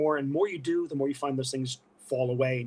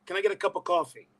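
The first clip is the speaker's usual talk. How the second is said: higher, broad Brooklyn "coffee"; Brooklyn accent; 4 semitones higher, faster than usual. Shown as a demonstration. Showing how tiredness brings it back, Brooklyn accent. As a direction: let the Brooklyn slip out. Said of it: "Brooklyn right there".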